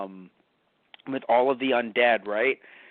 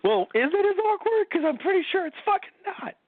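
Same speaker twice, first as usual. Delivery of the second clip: mocking high voice.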